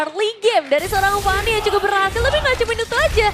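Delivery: high, loud hyped analysis; excited commentary. Real, not acted. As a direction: ride the hype of the play. Co-caster reacts to kills.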